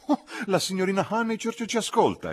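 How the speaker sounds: put-on high, loud, piqued old lady; peeved exclamation. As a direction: snap it out, put out at being overheard. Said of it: "esclamò indispettita". (esclamazione indispettita, acuta e forte)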